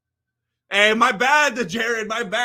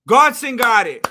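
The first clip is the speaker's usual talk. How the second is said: excited announcement; 8 dB louder than usual. Real, not acted. Reveal of winner, louder.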